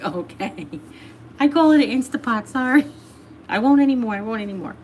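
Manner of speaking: mock-contrite promise to stop; mock apology. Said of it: "I was like".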